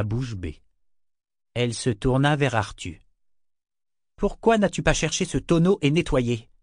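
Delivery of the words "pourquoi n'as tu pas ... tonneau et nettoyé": stern reproach. A narrator's reading. Officer scolds a sailor.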